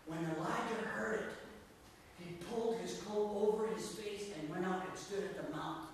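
normal talking voice

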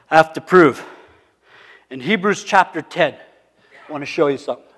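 ordinary talk, nothing marked

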